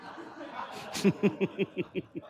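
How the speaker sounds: a short breathy chuckle; real amused chuckle; amused laugh. Real, not acted. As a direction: laugh lightly at the joke. Chair laughs at own joke.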